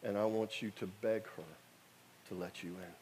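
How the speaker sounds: Holy Spirit speaking. Retold or imitated. Holy Spirit to the kneeling preacher, low hushed voice. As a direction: bring the inner voice down to a hush. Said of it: "And the Holy Spirit said".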